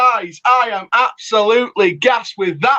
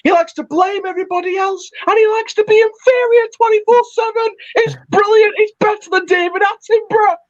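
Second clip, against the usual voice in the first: high, fast, gleeful yelling; crowing with delight at the win; gleeful shouting; 10 semitones higher, faster than usual. Real, not acted. Gleeful caller mocking rivals.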